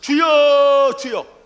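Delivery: high, loud "주여" for all to repeat; loud call to the Lord. Shown as a demonstration. Preacher leading the church in calling out. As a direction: lead the call, then let it fall. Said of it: "따라서 합시다".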